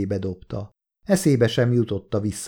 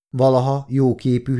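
ordinary delivery

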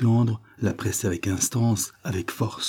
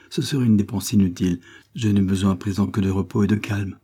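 ordinary delivery

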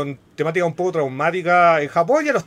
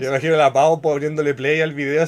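normal talking voice

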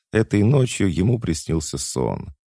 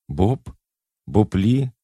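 questioning call, rising in pitch; tentative calling; 5 semitones higher, slower than usual. A narrator's reading. Woman calls to Bob in the dark room, questioning call. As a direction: call a name into the dark, unsure who is there. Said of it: "позвала она в темноте".

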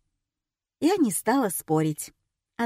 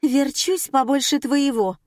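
high, loud indignant huff; huffy snort; 6 semitones higher, 4 dB louder than usual. Acted. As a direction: snap back with a huff. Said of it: "фыркнула Светлана". (фыркая, с обидой)

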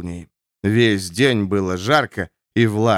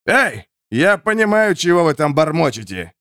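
yelling; 5 semitones higher than usual. A narrator's reading. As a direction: shout it down the wall. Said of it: "Рыжий заорал вниз".

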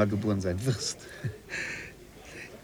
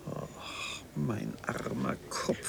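weary sigh; 13 dB quieter, slower than usual. Acted. Overwhelmed by time paradox.

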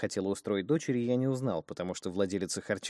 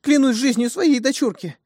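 desperate pleading; 12 dB louder than usual. Acted. Terrified woman swears innocence.

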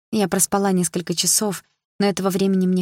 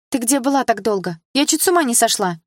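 higher, anxious scolding from the doorway; worried friend's outburst; 6 semitones higher, 3 dB louder than usual. A narrator's reading. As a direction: pounce on her with alarm. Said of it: "Накинулась на меня подруга". (подруга набрасывается с порога, встревоженно и громко)

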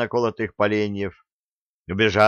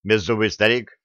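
ordinary delivery, nothing marked

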